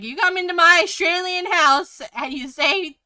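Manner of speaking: loud, high mock-angry dad; a jokey, hammed-up telling-off; mock outrage. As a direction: play the outraged Aussie dad for laughs. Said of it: "He's joking".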